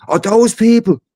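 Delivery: high, sing-song "oh, those people" as the woman; a high mocking voice for the charity woman; mock sudden realisation. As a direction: imitate her twigging it late in a high voice. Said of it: "And she's like".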